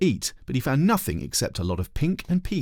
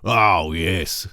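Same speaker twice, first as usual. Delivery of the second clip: persuasive; 4 dB louder than usual. A narrator's reading. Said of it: "said persuasively".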